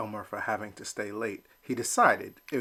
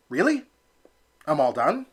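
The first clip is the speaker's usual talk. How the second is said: surprised; 5 semitones higher, 5 dB louder than usual. Acted. Elmer surprised he's out.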